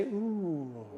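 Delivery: soft, breathy-free little impressed ooh; a quiet prompted ooh for the crowd. Shown as a demonstration. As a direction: coo like an impressed audience. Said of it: "Everybody say".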